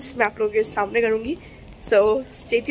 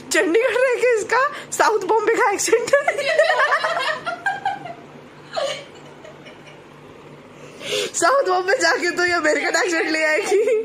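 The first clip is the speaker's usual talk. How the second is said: laughing through the words, higher; 9 semitones higher than usual. Real, not acted. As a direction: cracks up while teasing her accent. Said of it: "I love your accent".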